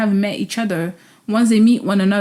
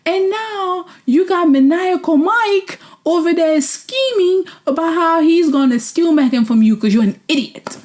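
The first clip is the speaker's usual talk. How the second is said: ordinary talk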